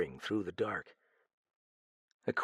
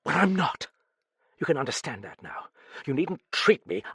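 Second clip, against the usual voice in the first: raised, indignant outburst; angry protest; 6 semitones higher, 5 dB louder, faster than usual. Acted. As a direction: push back sharply, voice jumping up. Heroine's angry outburst, just snarled.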